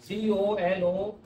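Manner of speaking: सीओएलओएनईएल read out slowly one letter at a time; spelled letter by letter. Shown as a demonstration. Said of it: "अंग्रेजी में एक शब्द होता है". (धीरे धीरे एक एक अक्षर बोलकर)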